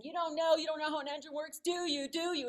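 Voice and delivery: high, quick, relentless pestering; badgering child. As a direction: imitate a kid needling without a breath. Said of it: "He kept badgering me and badgering me".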